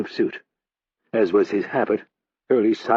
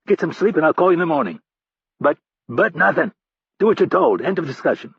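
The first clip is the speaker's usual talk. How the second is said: angry telling-off; 8 semitones higher, 4 dB louder than usual. Acted. Hurley chewing out Rap by phone.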